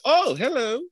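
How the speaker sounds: high "Oh, hello" with a lilt; mock posh hello. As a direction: imitate a showy wife. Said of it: "just like".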